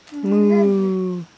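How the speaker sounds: long, even moo; drawn-out cow sound. Shown as a demonstration. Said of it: "Aaron, the cow".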